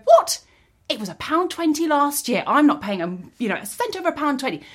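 high, loud outraged customer; indignant outburst. Retold or imitated. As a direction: shriek it like the price is an insult. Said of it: "You go".

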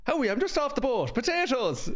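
mock Irish accent. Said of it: "do a really good Irish accent".

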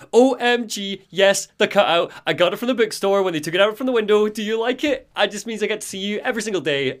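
high, fast gushing fangirl; gushing Rose. Acted. Rose gushing to Alan about his cutout, high and rapid. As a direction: rattle it off in a high, excited rush. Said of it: "Rose is like".

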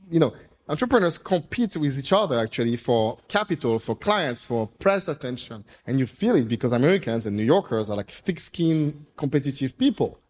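ordinary talk

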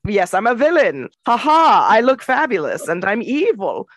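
lightly hammed-up gloating villain; a hint of a cackling villain; mustache twirling villain. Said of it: "like, a mustache twirling".

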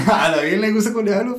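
giggling as he admits it; laughing. Real, not acted. Telling his friend girls love his accent, laughing.

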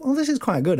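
high-pitched, pleased realisation; lightly acted delighted discovery; pleasant surprise. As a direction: sound mildly amazed at your own work. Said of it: "I thought".

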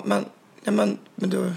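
high, halting stammer of disbelief; speechless stammering. Retold or imitated. Dancer lost for words before the guard, stammering. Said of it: "Jag blev helt mållös".

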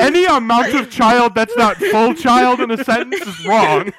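loud high words through laughter; loud laughing protest. Real, not acted. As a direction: protest while cracking up, slowly. Laughing at flying child.

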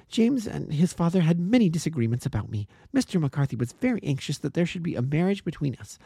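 put-on high, urgent young woman defending her friend; earnest young woman. A narrator's reading. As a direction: plead with fierce conviction. Miss Turner confesses the quarrels.

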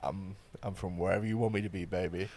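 low, soft sultry murmur; phone sex voice. Pretend. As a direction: purr it low and seductive. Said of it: "this is my phone sex voice".

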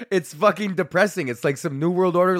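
ordinary delivery

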